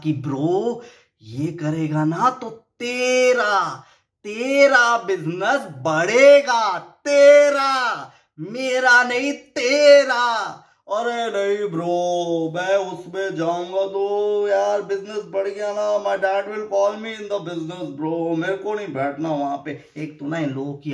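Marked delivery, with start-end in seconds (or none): 0.1-10.5 strained patience bursting into drawn-out, high yelling; suppressed anger erupting. Retold or imitated. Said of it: "patiently अपने गुस्से को अपने अंदर मार के". 10.9-19.9 drawling, louder mock rich-brat voice; spoilt rich kid. Imitating useless rich kid refusing work.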